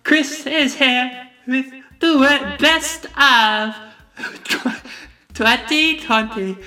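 very high, slow mock Aussie presenter with laughter; slow, squeaky fake Australian awards intro; atrocious Australian accent. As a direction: play Margot Robbie hosting in a bad Australian accent. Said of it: "This is absolutely atrocious".

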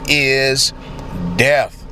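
ordinary talk, nothing marked

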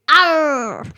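loud growling pirate Arr. Pretend. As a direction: bellow it like a pirate. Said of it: "what's your best pirate voice".

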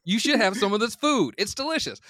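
oblivious breezy guy. Retold or imitated. Eric cheerfully unaware of the awkward dinner. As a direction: toss it off without a clue. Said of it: "just kinda like".

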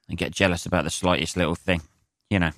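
normal talking voice

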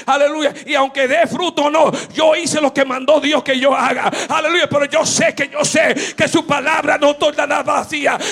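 loud, rousing sermon delivery; full-force preaching. Acted. Preacher urging the church to sow seeds of love, loud fervent preaching. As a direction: preach it hard, pushing every phrase.

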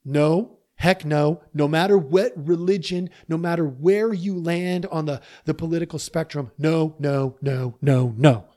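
loud, hammering refusal; emphatic no. Real, not acted. Speaker to audience on religion and politics, emphatic loud no. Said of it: "I'm gonna say something emphatically".

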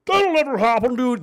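high, loud mocking scoff; mock doubter. Arguing with doubters about what is planned, mock scoff. Voicing doubters, then rebutting them.

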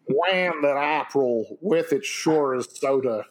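slow Southern drawl reading Chaucer; Tennessee accent. Pretend. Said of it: "Canterbury Tales in a Tennessee accent".

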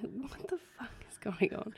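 retold startled whisper; a whispered what-the-hell; whispered disbelief. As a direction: hiss it under your breath like just woken. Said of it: "I'm like".

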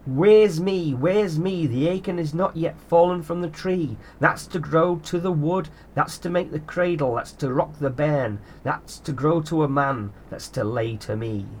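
slow, chanted rhyme in a sprite voice; grumbly old house spirit. Acted. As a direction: recite it like a wistful old spirit. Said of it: "he recited".

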